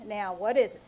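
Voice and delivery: usual speaking voice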